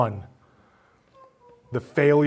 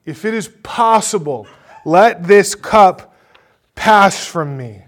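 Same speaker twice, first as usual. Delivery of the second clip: anguished crying out in prayer; 7 semitones higher, 11 dB louder than usual. Acted. Jesus pleading with his Father before arrest, crying out loudly. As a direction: cry it out much louder, stretching the words. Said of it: "You hear him crying out in prayer".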